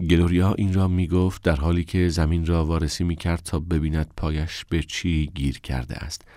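ordinary delivery

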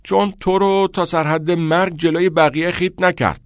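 loud retort. A narrator's reading. Boy protests to his teasing classmate, loudly. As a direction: answer back loudly and indignantly. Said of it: "پیتس در پاسخ با صدای بلند گفت".

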